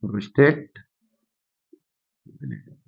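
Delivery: soft, low prostate, long pause, very faint gland; prostate rising, then a gap before a barely audible gland; slow dictation. Shown as a demonstration. Teacher dictates term with long pause.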